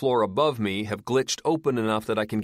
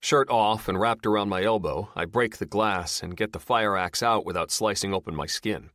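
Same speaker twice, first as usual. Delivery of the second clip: ordinary delivery, nothing marked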